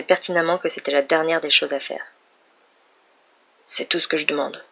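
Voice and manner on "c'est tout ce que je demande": understated gruff reply. A narrator's reading. Man ending his request to her, mildly gruff. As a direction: say it curtly, with a touch of gruffness. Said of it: "dit-il d'un ton bourru".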